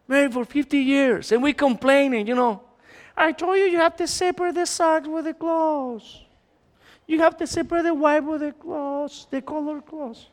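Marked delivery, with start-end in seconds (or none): 3.2-10.3 mock nagging wife, higher. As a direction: nag about the socks like a scolding wife. Wife nagging husband about socks.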